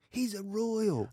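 high, drawn-out imitation of a fan; mock starstruck girl. Girl awed by Prince Harry, high slow mock gushing. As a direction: stretch it out high, as if explaining the obvious.

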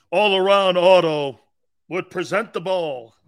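slow, loud sponsor announcement; mock-grand presentation announcement; announcer voice. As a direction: boom out the sponsor's name, stretching it.

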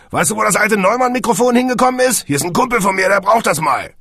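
high, loud shouted question; calling out. A narrator's reading. Elephant asking the technician about the old microphone, calling out. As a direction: holler it into the next room. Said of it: "rief er".

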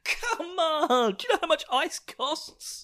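high, slow, laughing whine; whiny high character voice. Acted. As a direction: whine it like a put-upon villager. Voicing character complaining about ice.

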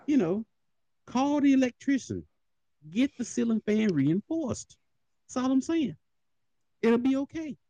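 very high, sing-out advice voice; high put-on voice of helpful advice; mock-innocent. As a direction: pitch it way up, playing innocent.

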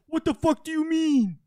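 hammed-up Jersey guy; mock Paulie. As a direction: imitate an outraged reality star. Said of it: "He's like".

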